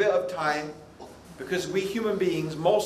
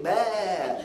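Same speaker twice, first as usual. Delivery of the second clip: drawn-out mock sheep's baa; sheep bleat.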